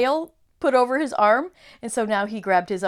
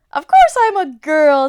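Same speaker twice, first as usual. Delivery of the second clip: loud, high insistent Lucy; loud indignant girl; 6 semitones higher, 14 dB louder than usual. Acted. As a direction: insist loudly.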